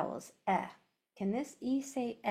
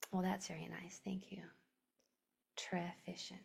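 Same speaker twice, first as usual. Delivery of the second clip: whispering; 3 semitones lower, 7 dB quieter than usual. Real, not acted. Teacher whispering to students.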